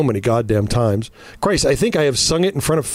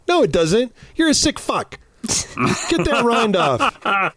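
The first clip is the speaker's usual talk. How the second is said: mock outrage; 9 semitones higher, slower than usual. Real, not acted. Ranting at cheese lovers.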